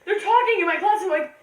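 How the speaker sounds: ordinary delivery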